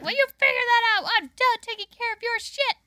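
high, loud exasperated crackhead impression; Rita. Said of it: "she was like".

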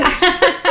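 short, high burst of laughter; loud laugh. Real, not acted. Woman confessing her anger to friends, loud laugh.

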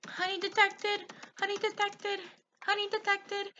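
high, evenly repeated catchphrase voice; imitated video voice. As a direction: mimic a high-pitched cartoon announcement.

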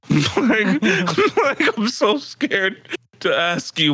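mock-scared, half laughing; incredulous with a laugh; laughing disbelief. Laughing retort to girlfriend's plan.

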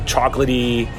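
ordinary talk, nothing marked

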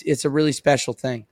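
normal talking voice

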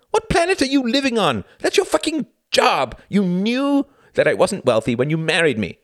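high, loud, outraged rant; shouted retort. A narrator's reading. Husband berates wife over housework and money, shouting. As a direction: hammer her with indignant accusations. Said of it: "shouted Reginald".

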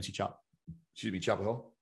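talking through a sip of coffee; words caught on a mouthful of coffee; sipping coffee. Real, not acted. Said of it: "Sorry, I drink coffee right now".

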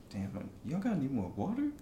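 ordinary talk

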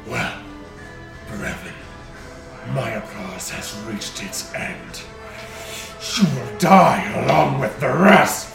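slow, then loud dragon threat. Acted. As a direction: menace him like a dragon. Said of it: "the dragon's booming voice".

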